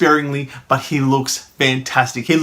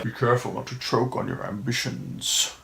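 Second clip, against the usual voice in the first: a bad Vader impression muttered as a threat; terrible Darth Vader; 2 semitones lower, 11 dB quieter than usual. Vader warns Krennic with his back turned, menacing and hushed. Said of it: "I do a terrible Darth Vader impression".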